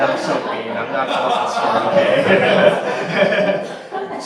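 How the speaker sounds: hammed-up defiance through laughter; laughing mock defiance.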